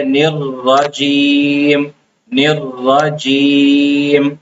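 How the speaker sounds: "نر رجیم" very slow, half chanted, ra held double; correct doubled ra. Shown as a demonstration. Teacher recites the correct "نر رجیم" for students. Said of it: "اس کا صحیح تلفظ ہے".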